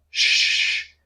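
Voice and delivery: drawn-out hushing noise, no voice; long shushing "sh". Shown as a demonstration. Teacher shows how to silence a person, long shush. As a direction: shush someone with a long "shhh". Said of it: "Si quiero callar a una persona".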